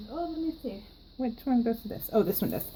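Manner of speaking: ordinary talk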